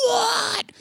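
high-pitched frantic mock yell; imitated yelled reply. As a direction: yell back, hammed-up and rattled. Rocco yelling back in clip.

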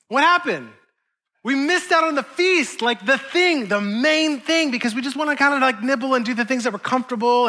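high, urgent preacher lifting the room; pleading sermon peak; impassioned preaching. Acted. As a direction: drive the point home, pitch climbing. Preaching climax.

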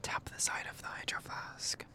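hushed ASMR whisper. Acted. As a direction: keep it a soft whisper.

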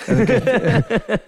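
can't hold back the laugh while telling it. Real, not acted.

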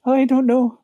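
put-on high-pitched timid Irish girl. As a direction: imitate a timid young Irish clerk. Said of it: "she's like that timid Irish".

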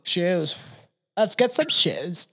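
slow, sing-song flamboyant voice; a touch of flamboyant camp; mock flamboyant. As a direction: camp it up, drawn out. Mocking a flamboyant request.

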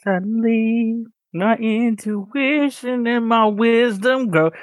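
high, slow, grand reading; hammed-up story narration; dramatic narration. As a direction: announce it like an epic narrator. Reads story summary dramatically.

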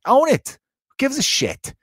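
high, punchy rallying call; fired-up encouragement. Real, not acted. Urging embarrassed listeners to own their problems, fired up.

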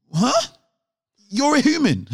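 high, disbelieving huh; incredulous. Real, not acted. Disbelieving outburst.

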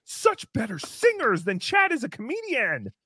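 put-on high, overexcited children's host; kids show host. Mock kids' show host praise.

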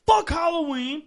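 joking shout against Halloween; mock outburst. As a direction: shout it like you mean it, then don't. Mock outburst, then "I'm kidding.

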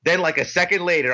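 normal talking voice

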